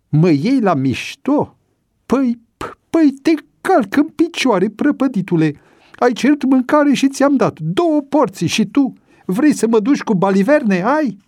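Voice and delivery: very high, loud furious tirade; enraged shouting. A narrator's reading. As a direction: scream threats at the prisoner. Sergeant rages at mocking prisoner.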